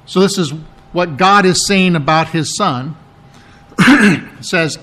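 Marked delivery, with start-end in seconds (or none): none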